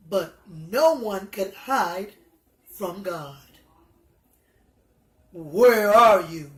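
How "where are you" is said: slow, louder calling out, lower; calling God. Acted. God searching for hiding Adam and Eve, slow loud call. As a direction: call out slowly into the garden. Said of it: "asked God".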